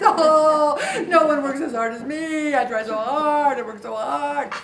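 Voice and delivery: a mock martyr's loud protest; self-pity whine. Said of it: "I have a pity party".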